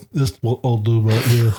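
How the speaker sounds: lazy-tongued mock British; put-on British with a thick tongue; fat-tongued British accent. Shown as a demonstration. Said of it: "It's gotta be like a fat tongue".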